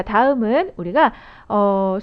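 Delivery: ordinary talk, nothing marked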